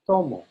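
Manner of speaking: TO of "Tomo" strong, falling on mo; stress on first syllable. Shown as a demonstration. Teacher repeats the correct form, TO stressed in "Tomo". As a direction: hit TO in "Tomo". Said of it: "is a little bit louder than mo".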